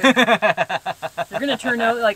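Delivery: laughing loudly while teasing; teasing through laughter. Real, not acted. Teasing about the side door.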